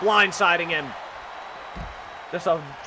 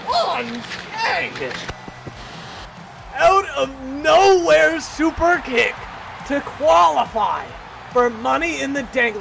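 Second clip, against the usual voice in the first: higher, slow and stretched, announcing the victor; grand winner call; 6 semitones higher, slower than usual. Real, not acted. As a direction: savor the win for the crowd. Winner announced after super kick.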